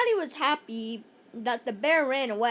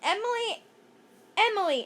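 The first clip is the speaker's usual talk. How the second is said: mild call; 6 semitones higher, faster than usual. Acted. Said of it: "Andrea shouted".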